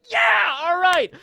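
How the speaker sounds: high, fired-up whoop; loud fan excitement; hyped cheer. Acted. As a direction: cheer like your hero just showed up. Acting out hyped reaction to Gaara.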